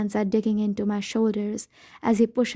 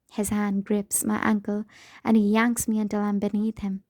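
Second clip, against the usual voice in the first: ordinary delivery, nothing marked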